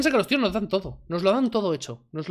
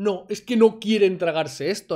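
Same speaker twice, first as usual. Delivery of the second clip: ordinary talk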